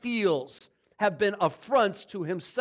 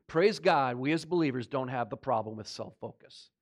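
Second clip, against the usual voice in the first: tongue in cheek; 6 semitones lower than usual. Acted. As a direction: say it deadpan, meaning the opposite. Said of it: "Tongue in cheek, that was said".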